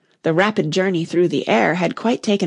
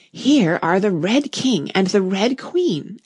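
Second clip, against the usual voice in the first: usual speaking voice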